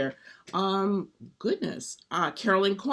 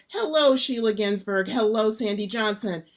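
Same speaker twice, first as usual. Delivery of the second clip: usual speaking voice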